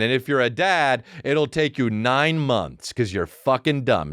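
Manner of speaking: ordinary delivery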